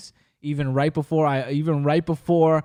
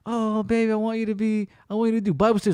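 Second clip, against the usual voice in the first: put-on lightly higher, sweet girlfriend voice; higher pitched coaxing girlfriend imitation; mock girlfriend voice; 5 semitones higher, much faster than usual. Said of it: "she was like".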